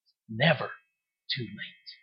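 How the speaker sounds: Jesus softly consoling the grieving sister; tender reassurance. Retold or imitated. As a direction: hush it gently like comfort to the grieving. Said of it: "Jesus said".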